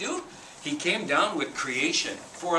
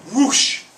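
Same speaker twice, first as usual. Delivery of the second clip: a big loud whooshing sound, pitched up; 8 semitones higher, 11 dB louder than usual. Acted. Storyteller sounding Raven's magic, loud whoosh. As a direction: make the magic whoosh for the kids.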